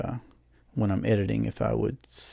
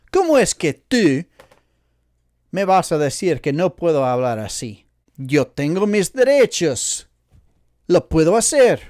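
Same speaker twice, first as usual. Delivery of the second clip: loud, higher mock protest; mock indignation; 4 semitones higher, 6 dB louder than usual. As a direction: demand your rights in a hammed-up huff.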